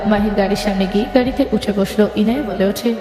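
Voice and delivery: ordinary delivery, nothing marked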